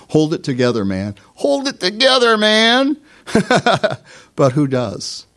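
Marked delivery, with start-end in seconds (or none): 1.3-3.0 high, hammed-up hold it together man; mock high bro encouragement; mock pep talk. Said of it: "we hear it spoken". 3.3-4.0 light laugh. Real, not acted. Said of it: "laughs after mock line".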